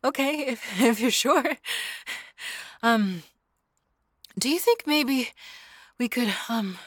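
bubbling up with nerves; shy excitement. Acted. Girlfriend shyly asking the listener to cuddle, eager.

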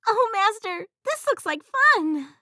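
high, loud delighted genie; thrilled, high and loud. Acted.

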